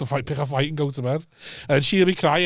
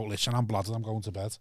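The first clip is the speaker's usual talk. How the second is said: low-key admission; 6 semitones lower, 10 dB quieter than usual. Retold or imitated. Voices a humble admission dad avoids.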